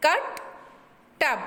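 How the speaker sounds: "कट, टब" with a short uh; short U sound. Shown as a demonstration. Teacher shows students the short U, short uh in "कट, टब". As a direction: clip the uh short in "कट, टब". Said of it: "यू का जो short vowel sound".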